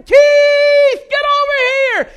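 piercingly high, very loud call; screaming. Retold or imitated. Swimmer calling a friend who still can't hear, screaming. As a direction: scream to be heard over a motor. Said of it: "And I'm screaming".

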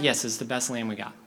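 low, patient dad's answer; reassuring dad. Acted. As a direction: answer a fretting son evenly. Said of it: "And the dad's like".

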